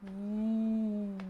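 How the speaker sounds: ooh through puffed-out cheeks; puffy-cheek woo. Shown as a demonstration. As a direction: blow it out through the lips. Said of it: "Try that one again".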